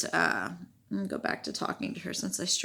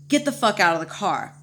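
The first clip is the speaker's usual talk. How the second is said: retold urgent order; 7 dB louder than usual. Retold urgent order to flee car.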